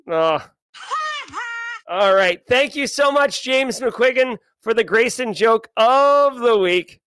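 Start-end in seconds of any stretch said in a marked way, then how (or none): 0.9-1.8 high squeal laugh. Real, not acted. Reacts to bad pun.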